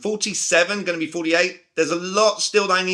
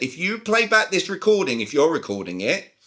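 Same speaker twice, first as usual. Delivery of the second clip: normal talking voice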